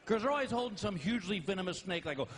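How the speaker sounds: ordinary delivery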